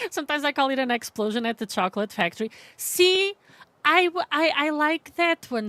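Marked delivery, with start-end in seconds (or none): 2.8-5.7 high, loud burst of delight; reacting to a funny chat answer with excitement; excited. Real, not acted. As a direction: jump in with delight.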